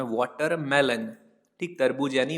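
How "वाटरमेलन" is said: Watermelon said slowly, syllable by syllable, falling at the end; slow clear repeat. Shown as a demonstration. Teacher repeats the English word watermelon for Hindi-speaking students, slow and clear. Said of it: "watermelon बोला जाता है English में".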